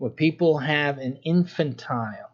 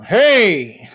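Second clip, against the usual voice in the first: loud, higher parental "Hey"; stern shout; 6 semitones higher, 10 dB louder than usual. Shown as a demonstration. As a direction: snap "Hey" to freeze the kid. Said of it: "hay que ser fuertes en su carácter, su voz".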